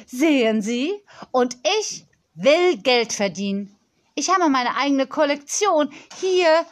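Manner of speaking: put-on emphatic, self-assured girl; insistent young girl. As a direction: press the point like a headstrong little boss.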